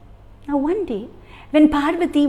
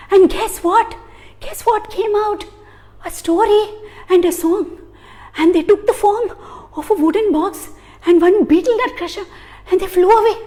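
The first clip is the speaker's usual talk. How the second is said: higher, louder eager gossip; excited gossip; 7 semitones higher, 4 dB louder than usual. Acted. A gossiping flame telling the others, excited. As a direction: spill the juicy news with glee.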